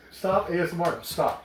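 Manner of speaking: loud sharp voice. Real, not acted. Stopping friend's ASMR, raised voice.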